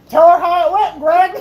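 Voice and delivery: high, slurred mock accusation; numb mouth. Patient to dentist before mom, numb-mouthed indignation. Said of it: "with, like, a numb freaking mouth".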